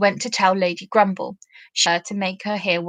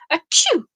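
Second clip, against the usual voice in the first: sneezing; 8 semitones higher than usual. Acted. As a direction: sneeze hard, twice. Tag sneezing.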